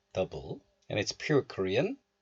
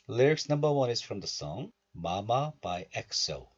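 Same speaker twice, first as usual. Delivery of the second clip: usual speaking voice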